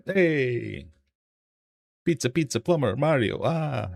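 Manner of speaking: cartoonish Italian plumber accent. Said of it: "That's my Italian accent".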